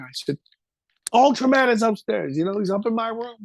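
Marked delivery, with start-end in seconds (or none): 1.1-3.4 put-on high, hurried little-boy voice; a scared kid blurting it out to dad; frightened child. Scared child tells his father.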